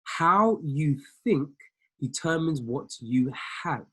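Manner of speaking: drawn out at half speed, each word weighted; very slowly. Shown as a demonstration. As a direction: spell it out slowly so it sinks in. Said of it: "I'm gonna say it very slowly".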